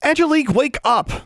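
high, loud shout through a door; banging on the door, shouting her awake. Acted. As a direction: yell to wake her roommate. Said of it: "Alexandra shouted".

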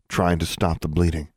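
ordinary delivery, nothing marked

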